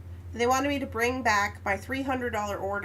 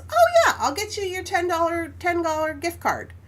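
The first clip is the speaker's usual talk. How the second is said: pitched way up, casual and loud; breezy high oh yeah; 7 semitones higher, 3 dB louder than usual. Retold or imitated. Manager caving in to her at once, breezy high voice. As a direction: toss it off like it's nothing.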